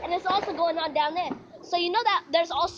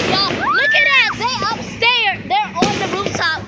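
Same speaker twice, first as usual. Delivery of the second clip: loud, high, thrilled call to the viewers; excited shout; 5 semitones higher, 10 dB louder than usual. Real, not acted. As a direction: yell it out, amazed at the fireworks. Fireworks seen on the rooftop.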